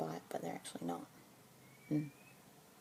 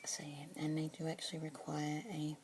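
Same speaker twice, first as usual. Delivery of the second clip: asmr whisper. Acted. Keeper introducing a budgie to a listener, whispered. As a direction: whisper gently, close to the mic.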